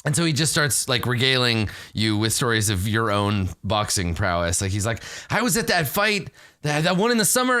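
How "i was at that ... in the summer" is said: high-pitched eager reminiscing; excited fan. Acted. Game master as fan regaling fighter with his bouts, excited. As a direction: gush about having been there, pitched high. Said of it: "he's very excited that he knows you".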